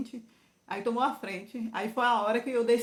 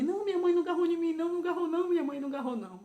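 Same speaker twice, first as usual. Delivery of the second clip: higher, fast, urgent denial from a frightened daughter; daughter's quick denial; 7 semitones higher, much faster than usual. Retold or imitated. Daughter Milene reassures her mother, higher and rushed. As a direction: rush it out to reassure mom she wasn't hit.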